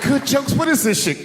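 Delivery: high, outraged wife voice; imitated wife, high and scornful; mock indignation. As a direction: throw it back in high-pitched disbelief. Wife scoffs at his jokes.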